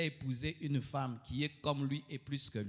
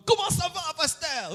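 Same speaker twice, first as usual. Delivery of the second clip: high, loud fawning greeting; mock-flattering greeting to the pastor, high and loud; 13 semitones higher, 6 dB louder than usual. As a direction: ham up a flatterer's hello. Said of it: "tu rencontres l'homme".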